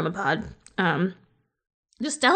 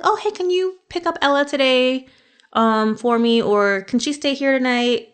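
higher, sugary ask, like a friendly neighbor; butter them up with a bright, higher little request; sweet favor-asking voice; 6 semitones higher than usual. Pretend. Con artist sweetly asking the mark to take her kid, pitched up.

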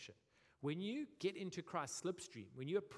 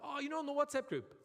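much higher, offhand mate; breezy mate; 12 semitones higher, 5 dB louder than usual. Retold or imitated. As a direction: toss it off like a casual mate. Mates answering left-out friend.

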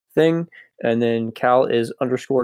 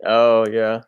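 ordinary talk

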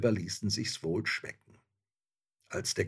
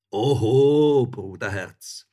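hearty protest; 3 semitones higher, 12 dB louder, slower than usual. A narrator's reading. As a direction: boom it out in jolly objection. Soldier objects to leaving.

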